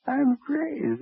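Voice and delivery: a giggle that leaps high into the words; manic laugh. Acted. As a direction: gloat that you're mad, pitched high. Mocks the insanity plea.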